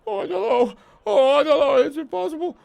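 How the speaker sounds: high, panicky pleading; a terrified seer begging off; panicked high voice. Acted.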